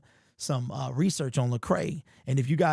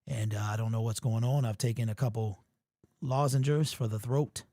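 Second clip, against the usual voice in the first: ordinary talk, nothing marked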